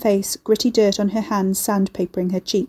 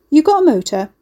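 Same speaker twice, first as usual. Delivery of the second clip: brash demand; 7 semitones higher, 6 dB louder than usual. A narrator's reading. Ricky grabs phone, demands.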